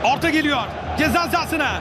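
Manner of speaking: normal talking voice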